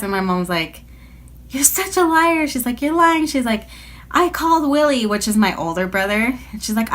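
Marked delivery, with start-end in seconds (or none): 1.5-5.1 high, sharp mock mom voice; imitated mom. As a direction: play the mom calling out the lie. Mom calling her a liar.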